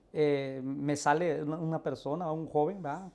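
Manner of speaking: ordinary delivery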